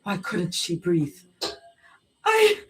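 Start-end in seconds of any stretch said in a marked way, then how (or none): 2.2-2.6 high choked sound; gagging sob. A narrator's reading. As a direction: choke on the word. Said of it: "she choked".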